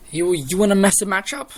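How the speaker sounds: loud, high taunt at the heels; taunting challenge. Retold or imitated. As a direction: call them out, a little mocking.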